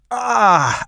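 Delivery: sharp high pained cry; loud, high pain shout. A narrator's reading. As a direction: yelp as the sore leg drops. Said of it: "mit einem lauten".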